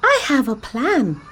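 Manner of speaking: squeaky straw asking for rescue, urgent; Emmy the straw pleads with Kim to save her family; Emmy the straw pleading. Acted. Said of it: "Emmy explained".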